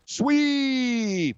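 high yelled sweep call; curling skip yelling. Acted.

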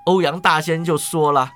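usual speaking voice

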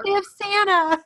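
high, thrilled squeal of delight; excited Andy. Retold or imitated. Andy thrilled at spotting Santa, high squeal. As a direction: gasp it out like a kid seeing Santa. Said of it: "Andy's like".

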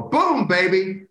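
put-on 模仿解说员拖长声音喊"Boom, baby"; imitating Slick Leonard. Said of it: "you'd hear Slick Leonard go".

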